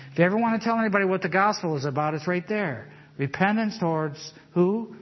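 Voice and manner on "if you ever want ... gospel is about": normal talking voice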